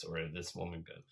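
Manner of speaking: ordinary delivery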